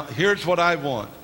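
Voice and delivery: ordinary talk, nothing marked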